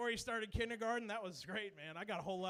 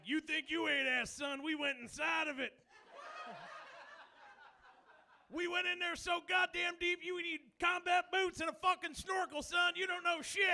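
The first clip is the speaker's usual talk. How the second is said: bragging shout; 6 semitones higher than usual. Retold or imitated. Comic bragging to his friend, shouting high. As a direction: yell it like a cocky bro.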